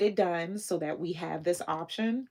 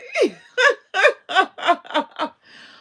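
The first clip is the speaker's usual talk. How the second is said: loud high laughing cry; laughing sob; 12 semitones higher, 13 dB louder than usual. Real, not acted. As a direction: laugh through happy tears. Laughs and cries at once.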